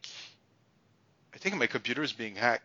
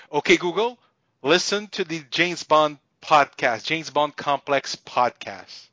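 loud command; 5 semitones higher, 6 dB louder, slower than usual. Real, not acted. Commanding a voice assistant.

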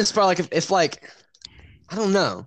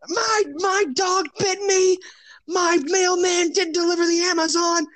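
mock scream; 12 semitones higher, slower than usual. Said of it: "She's screaming".

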